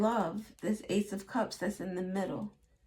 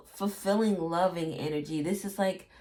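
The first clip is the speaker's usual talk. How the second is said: ordinary talk, nothing marked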